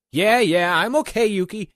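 high, loud, hurried brush-off; flustered reassurance. Acted. Kimu reassuring Yuki about his cut, flustered. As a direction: laugh off the cut, too brightly.

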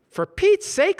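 very high, loud exasperation; exasperated. Acted. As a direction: throw up his hands. Brad exasperated.

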